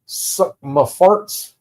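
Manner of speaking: slow, stretched-out words with a questioning lift; drawn-out crude joke. Acted. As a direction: draw each word out slowly, ending on a rise.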